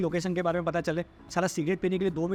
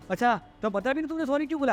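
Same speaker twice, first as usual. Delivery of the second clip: high-pitched imitation of an indignant girlfriend; mimicked offended disbelief; mock indignation; 7 semitones higher than usual. Imitating girlfriend challenging an apology.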